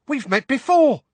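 very high, loud squeaky exclamation; excitable squeak. A narrator's reading. Excitable wizard greeting someone he has met, high squeak. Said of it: "squeaked the excitable Diggle".

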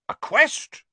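high, loud, excited question; thrilled knight. A narrator's reading. Exhausted knight hears they seek North Tower, thrilled. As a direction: leap up in pitch with sudden keenness. Knight's rage gives way to eagerness.

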